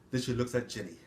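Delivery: ordinary delivery, nothing marked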